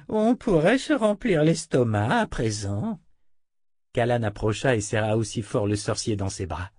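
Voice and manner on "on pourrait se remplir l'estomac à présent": put-on high, slow old man's voice; hungry old wizard. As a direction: wheedle for dinner as a quirky elder. Hungry old wizard urges a meal. (vieux sorcier affamé)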